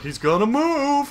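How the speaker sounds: high, loud, drawn-out heckle; mock douchebag. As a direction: call it out like an obnoxious cinema heckler, slowly. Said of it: "that obligatory one douchebag".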